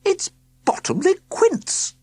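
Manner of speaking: very high, slow crying-out; sudden recognition. A narrator's reading. Aunt recognising her nephew, high and slow. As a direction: realise it all at once. Aunt's realisation.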